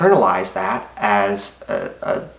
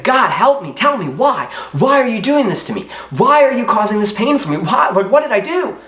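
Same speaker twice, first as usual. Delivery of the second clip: pleading to God, pitched high and straining; desperate plea; 9 semitones higher than usual. Retold or imitated. Alone in his room at night, he pleads with God in anguish. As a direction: cry out to God, begging for an answer. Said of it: "And I said".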